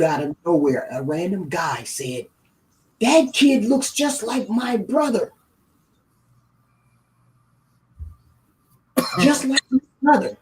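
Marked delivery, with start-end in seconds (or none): 3.0-10.4 higher, amazed voice of a stranger; a stranger stunned by the resemblance; surprised recognition. Retold or imitated. Stranger on Facebook sees the baby's photo, amazed.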